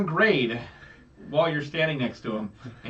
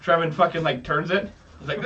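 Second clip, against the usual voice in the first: ordinary talk, nothing marked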